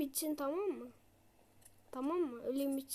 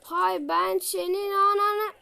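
slow, louder drawn-out curse; frustrated curse; 3 semitones higher, 6 dB louder, slower than usual. Real, not acted. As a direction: let it out through clenched rage. Cursing the boss in frustration.

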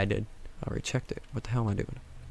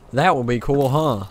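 much louder, punched-up musing; loud exclamation; 3 semitones higher, 10 dB louder than usual. Real, not acted. Gamer musing aloud to viewers, loud and emphatic. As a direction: belt it out over the game. Repeats it loudly in game.

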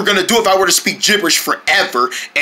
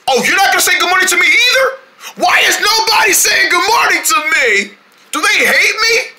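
whiny hurt; 9 semitones higher than usual. Acted. Hurt nobody greets him.

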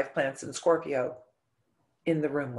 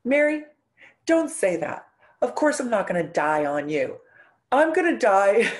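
louder, higher booming reassurance with a laugh in it; dad's loud teasing; 4 semitones higher, 6 dB louder than usual. Retold or imitated. Daughter voicing her dying dad teasing her, loud and pitched up. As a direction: boom it out like a big dad brushing off her fear. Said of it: "and he goes".

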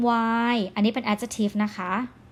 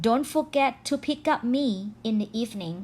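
slow example sentence; much slower than usual. Shown as a demonstration. Teacher slowly reading a sample line to students, very slow. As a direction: spell it out slowly for learners. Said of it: "จำไว้นะ".

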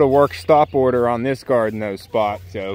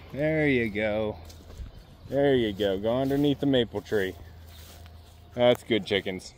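much softer, gentle guiding of the hens; soft coaxing; 2 semitones lower, 9 dB quieter than usual. Real, not acted. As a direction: ease them along quietly.

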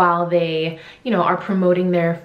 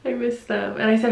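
ordinary talk

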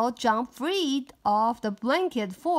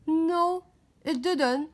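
higher, louder quick denial; denying boy voice; 7 semitones higher, 4 dB louder, slower than usual. Acted. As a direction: deny it fast. Tommy denies loudly.

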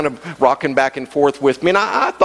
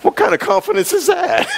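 much higher, laughing through the words. Real, not acted. Preacher laughing at his own boxing story, much higher. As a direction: crack up at the memory.

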